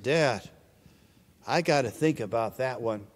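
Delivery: put-on soft, low hesitant little girl; a reluctant child stalling for time. Girl stalling when her father asks for the necklace, quiet and hesitant.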